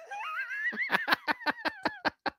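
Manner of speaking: high shrieking laugh. Real, not acted. Laughing at chat.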